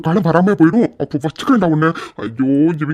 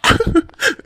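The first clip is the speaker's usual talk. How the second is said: 1 laugh; 11 semitones higher than usual. Acted. Prankster laughs at friend.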